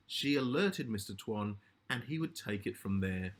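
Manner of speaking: ordinary delivery, nothing marked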